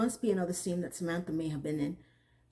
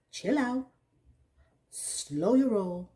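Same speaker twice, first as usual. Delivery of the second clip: Che's calming way; much slower than usual. Retold or imitated. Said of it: "calm her down in her Che way".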